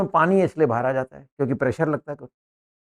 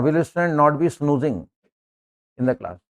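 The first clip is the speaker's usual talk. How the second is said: slow dictation; much slower than usual. Shown as a demonstration. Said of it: "dictating answer".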